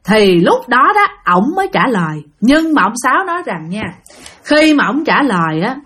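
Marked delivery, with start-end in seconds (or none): none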